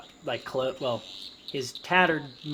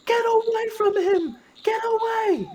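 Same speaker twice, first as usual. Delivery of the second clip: very high, loud motherly shriek; hammed-up scream; 7 dB louder than usual. Mother running to save her child, acted screaming. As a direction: scream it as a panicked woman. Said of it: "screaming at the top of her lungs".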